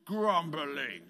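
low, slow, moany grumble; drawn-out grumpy groan; grumbling voice. Shown as a demonstration. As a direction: drag the word out like a sulky complaint. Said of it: "say it in a really kind of grumbling kind of way".

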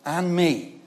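ordinary delivery

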